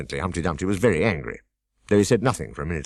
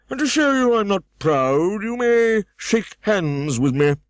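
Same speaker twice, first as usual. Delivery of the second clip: higher, louder pompous scold; Humpty Dumpty scolding; 11 semitones higher, 4 dB louder than usual. A narrator's reading. Humpty Dumpty scolding Alice, higher and louder. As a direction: snap at the girl from the wall.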